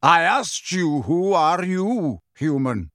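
slow, loud, insistent challenge; stern centaur, falling away on the last word; rough centaur demand. A narrator's reading. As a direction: repeat the question forcefully, stretching each word. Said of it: "said Magorian roughly".

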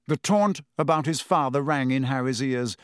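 ordinary delivery, nothing marked

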